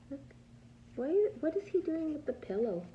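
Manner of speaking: ordinary delivery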